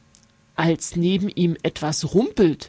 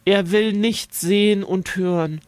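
ordinary delivery

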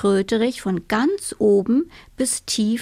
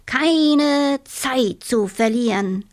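slow, higher, ardent toad cry; fervent cry; 4 semitones higher, slower than usual. Acted. Toad rushes out the door, fervently declaring. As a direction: declare it with fervor. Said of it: "mit der Inbrunst".

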